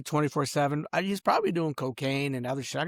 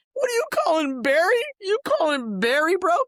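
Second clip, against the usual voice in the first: loud, much higher outraged questions; indignant question; 13 semitones higher, 4 dB louder than usual. Real, not acted. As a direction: demand an answer, outraged. Angry questioning of family.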